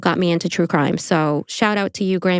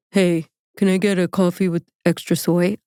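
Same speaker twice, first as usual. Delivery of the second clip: imitating a fake-cool coffee order, slightly low; mock too-casual customer voice; weird put-on voice; 3 semitones lower than usual. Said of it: "Why did you do this weird voice?".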